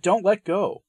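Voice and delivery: high, loud urgent shout; shouted command. Acted. Stranger shouting to Bosco as the snake thrashes: loud urgent cry. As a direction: yell it so he keeps hold. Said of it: "the stranger shouted".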